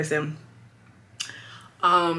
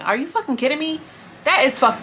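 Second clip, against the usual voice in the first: exasperated, pitched up high; real exasperated disbelief; 8 semitones higher than usual. Real, not acted. Commentator reacting to an insensitive question, exasperated.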